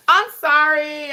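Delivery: high, loud, drawn-out sorry; slow, high-pitched, loud apology; exaggerated apology. Real, not acted. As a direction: stretch it out in a playful apology. Playful apology for talking fast.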